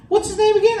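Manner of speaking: high, prissy front-desk lady voice; prim school receptionist. Retold or imitated. School lady questioning the stepdad, high prim voice.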